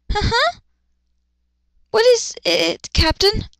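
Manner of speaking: high, slow timid voice; stuttering. Acted. Shy Luna answering her captain, high timid stutter. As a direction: stammer up at him. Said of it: "she stuttered".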